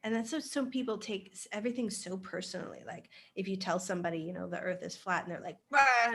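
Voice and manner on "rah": a short loud mock roar of outrage; mock rage outburst. Imitating a flat-earth objector's loud mock roar. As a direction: bark it like someone losing it. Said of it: "they, like, lose their shit".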